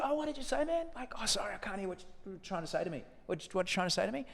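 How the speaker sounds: high, light and gentle, a little quick; gentle, high, friendly. Retold or imitated. Mock-friendly to a heckler, gentle and high. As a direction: soothe the drunk guy gently with a high friendly voice. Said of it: "just gently".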